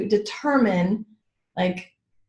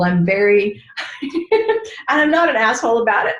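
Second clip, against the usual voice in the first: ordinary talk, nothing marked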